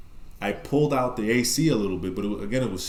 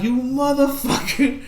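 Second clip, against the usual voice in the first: mock outrage; 13 semitones higher, 5 dB louder, slower than usual. Friend ran off and left him.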